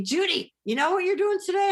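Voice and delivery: lightly acted eager phone greeting; peppy friends. Friends calling her in the morning, mildly hammed-up pep. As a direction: rouse a friend, pitch lifted high.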